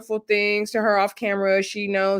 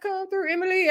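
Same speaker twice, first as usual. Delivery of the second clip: high-pitched, soft squeal of approval; hyped cheer; 11 semitones higher, 4 dB quieter than usual. Retold or imitated. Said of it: "I said".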